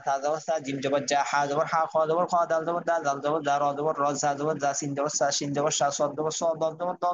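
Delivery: brisk, run-on recitation of letter spellings; one-breath spelling drill. Shown as a demonstration. Teacher modelling spelling practice for students, in one breath. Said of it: "বানানটা এক নিঃশ্বাসে বলবো".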